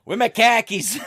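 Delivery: put-on high, loud laughing Boston accent; mock Boston guy saying "khakis". Cohost joins the bit in a laughing Boston accent. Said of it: "you gotta do the Boston accent".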